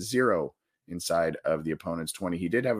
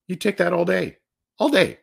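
excited; 9 semitones higher, 5 dB louder than usual. Real, not acted. Praising a star's stat line.

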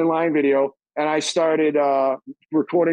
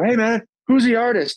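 much higher, eager ask; curious; 8 semitones higher than usual. Retold or imitated. Calling Frankie back, high curious ask. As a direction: chase the answer. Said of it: "I go".

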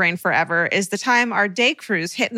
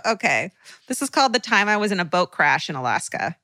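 ordinary talk

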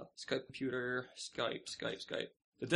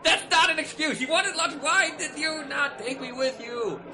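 mock indignant; 10 semitones higher, 4 dB louder than usual.

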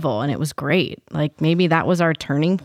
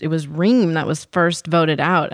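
ordinary talk, nothing marked

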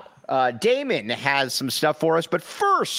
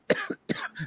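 sudden dry cough; 6 dB quieter than usual. Real, not acted. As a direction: cough mid-sentence. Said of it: "sorry, I'm losing my voice".

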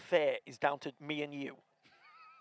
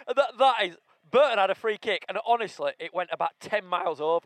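excited; 4 semitones higher, 7 dB louder than usual. Real, not acted. Ranting about bad free kick.

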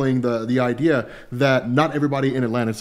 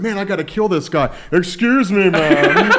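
mock hero voice; 5 semitones higher, 3 dB louder, slower than usual. Mocking hero's inner thoughts.

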